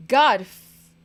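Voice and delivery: sudden loud frustrated burst; frustrated outburst. Real, not acted. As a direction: blurt it out in frustration. Frustrated outburst while drawing.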